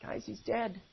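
quiet, dejected announcement; somber report. Acted. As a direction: break bad news softly. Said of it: "You know what he says?".

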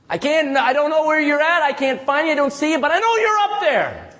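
high, insistent pleading; begging prayer. Retold or imitated. Preacher modelling prayer to God, begging insistently. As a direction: plead upward, stretching toward the last words. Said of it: "That's begging".

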